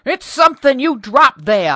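loud, high accusing shout; he insists, desperate for reason; almost shouting. Acted. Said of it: "almost shouted Mister Townsend".

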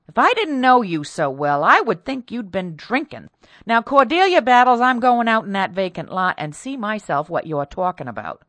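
loud, emphatic, high on the name; scold, then announce it decisively; indignant scolding. A narrator's reading. Mistress scolds her maid and takes charge, loud.

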